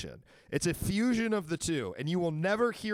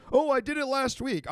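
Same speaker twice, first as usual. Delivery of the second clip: high, loud mock-casual reply; exasperated fake answer, pitched up; sarcastic mock answer; 10 semitones higher, 6 dB louder than usual. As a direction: throw it out high and loud, mocking the question. Sarcastic mock answer to host's question.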